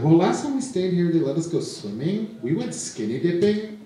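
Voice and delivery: high whiny protest, lightly voiced; tipsy girl pleading. Drunk girl arguing with the hotel guard, high whiny voice.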